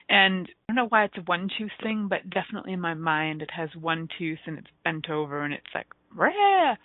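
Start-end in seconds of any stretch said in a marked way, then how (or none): 6.1-6.7 high, loud mock monster "rah"; monster roar. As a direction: roar like a one-toothed monster. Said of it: "that's the sound it makes".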